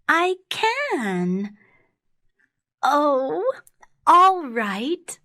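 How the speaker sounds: put-on high little girl giving in; high-pitched storybook girl. A narrator's reading. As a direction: agree in a high child's voice. Girl gives in to wolf.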